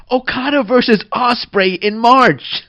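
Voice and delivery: high, loud shout of real excitement; excited outburst. Real, not acted. Podcaster interrupting his own sign-off, shouting excitedly. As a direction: burst out shouting, thrilled. Bursts out mid sign-off, thrilled.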